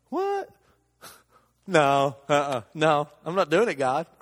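loud refusal to God to work for free; flat refusal. Retold or imitated. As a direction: push back on God, half laughing. Refusing God's request.

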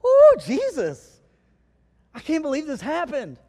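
high, loud, stretched-out joyful cry; excited. Retold or imitated. Disciples telling Jesus their news, overjoyed and loud. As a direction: gush like a thrilled disciple back from the road. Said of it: "they were excited".

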